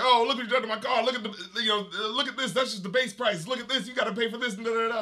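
high-pitched comic patter, imitating; mock fast-talk rant. Imitating Sinbad raging over his car, mock fast-talking. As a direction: rattle off a hammed-up complaint, trailing into filler. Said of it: "Talk fast, make funny, joke funny".

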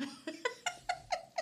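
high-pitched giddy giggle. Real, not acted. Giddy before telling her friends, high giggle. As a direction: giggle like a schoolgirl.

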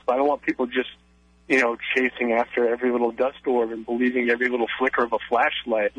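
ordinary delivery

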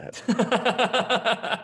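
drawn-out laugh, high. Real, not acted. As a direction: laugh through the words. Laughing at landing the hit.

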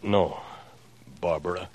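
low, halting denial; guarded impostor dropping the voice; cagey. Pretend. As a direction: pose as him, giving nothing away. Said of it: "You sound so cagey".

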